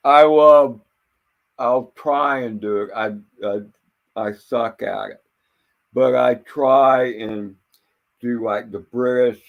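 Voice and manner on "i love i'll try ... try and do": ordinary talk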